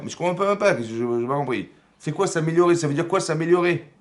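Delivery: high, loud protesting questions; exasperated bafflement. Acted. Cain not understanding God's demand, loud and exasperated. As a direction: demand an explanation, voice pushed up.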